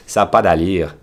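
Canadian French lilt on Ça n'a pas d'allure; Québécois accent. Shown as a demonstration. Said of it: "the accent is".

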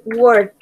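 WORKED ending in a T; ED of WORKED pronounced as T; final T sound. Shown as a demonstration. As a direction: say WORKED as one syllable, "workt". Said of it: "es como una T al final".

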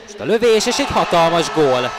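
excited hungarian sports commentary. Real, not acted. Goal call in hockey highlights.

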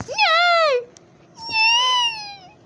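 very high, drawn-out cries of no; wailed "no". Real, not acted. Player losing in the game, high wail.

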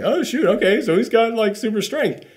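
high, startled realisation; suddenly discover your own strength; surprised. Retold or imitated. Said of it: "It's like".

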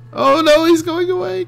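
high-pitched wail; mock dismay. Player watches his machine leave, mock dismay. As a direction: wail as it gets away.

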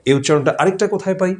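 usual speaking voice

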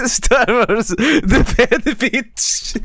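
slow, high, giggling repeat; laughing. Real, not acted. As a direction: say it again through giggles. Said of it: "laughing repeat of joke".

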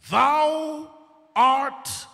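high, loud ringing declaration; Peter's bold shout of faith; proclaiming. Acted. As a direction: ring it out like a bold confession. Peter's confession, preacher builds.

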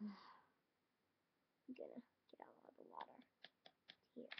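sighed then whispered; weary whisper while cleaning up. Acted. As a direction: whisper it sadly to yourself. Sighs, cleaning up tears.

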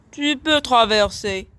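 ordinary delivery, nothing marked

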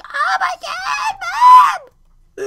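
hammed-up high-pitched cry for help; mock scream. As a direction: scream for mom in mock terror. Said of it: "And I'd freaking scream".

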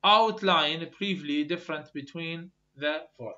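slow, loud dictation of the question; slow question reading. Shown as a demonstration. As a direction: dictate each English word to the class. Teacher reads exam question aloud.

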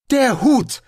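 high, loud outburst; herausplatzen. A narrator's reading. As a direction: blurt it out excitedly. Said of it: "platzte es aus dem Touristen heraus". (begeistert herausgeplatzt)

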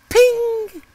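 high, bright ping; ping sound. Retold or imitated. Boy imagining the pellet hitting the can, high ping. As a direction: chime it like the hoped-for hit.

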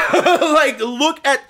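laughing through the words; laughing half in panic; nervous laugh. Real, not acted. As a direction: giggle in nervous excitement. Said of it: "I'm having a panic attack".

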